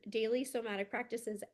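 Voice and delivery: normal talking voice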